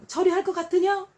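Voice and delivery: high, loud scolding; angry rebuke. Retold or imitated. God voiced rebuking people, high and loud. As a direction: thunder the questions down. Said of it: "막 이러죠".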